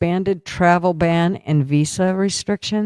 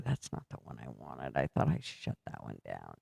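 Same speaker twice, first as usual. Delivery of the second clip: muttering under breath; 18 dB quieter than usual. Real, not acted. Annoyed muttering at a popup.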